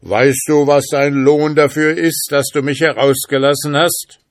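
loud, high, menacing spirit; terrible voice. A narrator's reading. Freed spirit threatens his rescuer, terrible loud voice. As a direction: thunder the question at full voice. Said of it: "rief er mit einer fürchterlichen Stimme".

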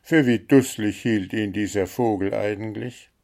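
ordinary delivery

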